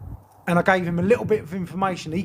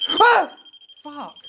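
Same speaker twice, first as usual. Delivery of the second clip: high yelp, then a muttered curse; jump, yelp, then swear; startled yelp; 9 semitones higher, 6 dB louder than usual. Real, not acted. Startled again.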